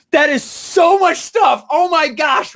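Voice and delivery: slow, loud, high mock panic; overwhelmed outburst. Students reacting to all the material, overwhelmed outburst.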